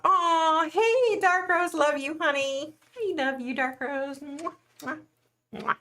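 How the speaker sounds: sugary high greeting with air kisses; gushing fan greeting. Real, not acted. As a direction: coo affectionately at a favourite viewer.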